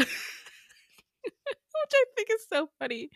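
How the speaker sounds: laughing. Real, not acted. Amused by Cole flirting with Becca, giggling. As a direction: laugh through the words.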